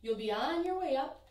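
plain reading voice

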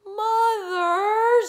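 very high, loud drawn-out wail; bawling. A narrator's reading. Crybaby calling her mother, loud bawl. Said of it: "she bawled".

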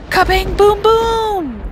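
loud high thunder bang; booming thunder. A narrator's reading. Thunder sound read aloud.

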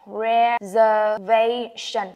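Reservation drawn out slowly and loud with stress on va. Shown as a demonstration. Teacher models Reservation slowly stressing va. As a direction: stretch out Reservation, hit va. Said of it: "Nó nhấn vần với".

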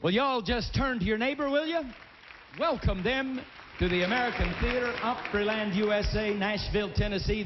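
high, rousing TV host call-out; rally the crowd like a live show host; announcer welcome. Acted. Host welcomes the theater crowd, rousing and high.

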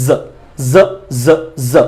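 জ as a voiced buzzing z, repeated; buzzing z sound. Shown as a demonstration. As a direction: vibrate inside the mouth on জ. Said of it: "আবার বলি". (মুখে কাঁপিয়ে জ)

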